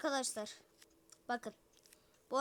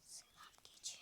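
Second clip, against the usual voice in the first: whisper; 22 dB quieter than usual. Pretend. Whispered greeting to goat.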